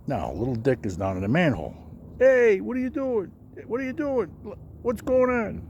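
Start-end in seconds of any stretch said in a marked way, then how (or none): 2.2-5.6 high, loud alarmed cries up from below; panicked yelling. Retold or imitated. Little Dick, sealed in the manhole, yelling up in alarm. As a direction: shout up in a panic, trapped underground.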